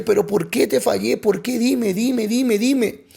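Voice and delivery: high, pleading retold cry to God; acted anguished begging for an answer; imitated tearful pleading. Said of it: "yo llorando le dije".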